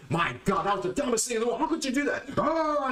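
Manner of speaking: high-pitched hysterical outburst; mock yelling. Acting out a man losing control at his girlfriend, mock hysterical yelling. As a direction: throw an exaggerated fit, voice shooting way up. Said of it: "and then you start yelling".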